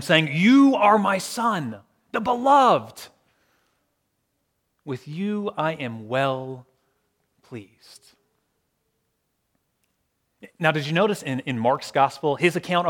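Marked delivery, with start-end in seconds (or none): 0.3-3.1 slow, high proclamation from heaven; crying out. Acted. Voice from heaven to Jesus at his baptism, crying out. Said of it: "cried out to him". 4.8-8.1 slow, low and fading softly; tender approval. Acted. Voice from heaven approving of the Son, tender.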